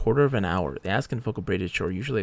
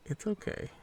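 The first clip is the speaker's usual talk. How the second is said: higher and softer it's okay, almost hushed; higher, hushed it's okay for a weak companion; soft reassuring; 10 semitones higher, 8 dB quieter than usual. Pretend. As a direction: whisper comfort to the little guy. Comforting weak game character.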